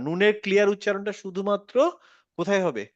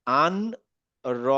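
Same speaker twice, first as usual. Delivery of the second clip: normal talking voice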